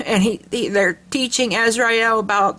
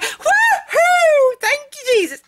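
joyful whoop; slower than usual. Retold or imitated. Mother hearing her daughter's news, tearful high whoop. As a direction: shriek it to the sky, overcome. Mom hears daughter got interview.

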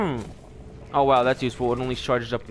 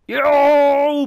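loud, high yell of triumph; excited shout; 9 semitones higher, 7 dB louder than usual. Real, not acted. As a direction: bellow it at the takedown. Shout at a kill.

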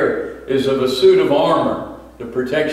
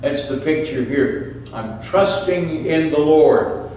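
ordinary talk

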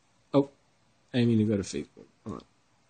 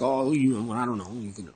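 softer, mumbled mock reply; mock mumbling; 2 semitones lower, 8 dB quieter than usual. Ranting about a teacher, mimics his softer mumbled dodge. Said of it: "He's like".

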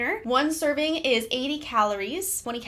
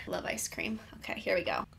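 soft whisper, no voice; 4 semitones lower, 7 dB quieter, faster than usual. Real, not acted. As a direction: breathe the words in a whisper. Whispering before taking a bite.

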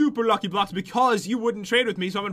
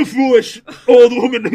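loud put-on grumpy old man; 2 semitones higher, 13 dB louder than usual. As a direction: scold her like a crotchety elder. Teasing partner, loud put-on.

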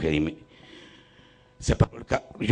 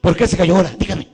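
demanding, pitched up and louder; 7 semitones higher, 5 dB louder, faster than usual. Retold or imitated. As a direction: press him hard for an answer. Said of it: "le digo".

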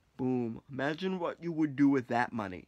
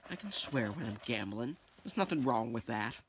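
normal talking voice

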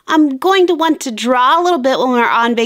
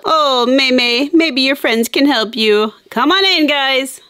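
ordinary talk, nothing marked

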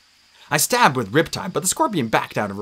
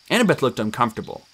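ordinary delivery

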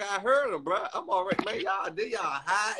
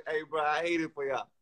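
ordinary delivery

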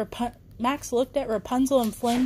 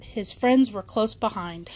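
ordinary delivery

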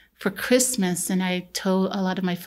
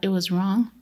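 ordinary talk, nothing marked

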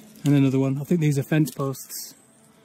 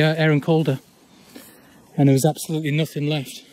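ordinary talk, nothing marked

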